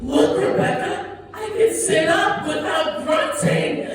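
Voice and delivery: put-on high, loud, drawn-out toddler boast; proud toddler. Toddler in physical therapy showing off to Rebecca, proud and high. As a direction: show off like an excited little kid. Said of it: "it really feels like I'm a toddler again".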